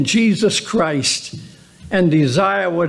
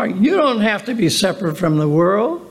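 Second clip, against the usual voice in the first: normal talking voice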